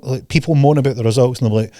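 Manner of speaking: normal talking voice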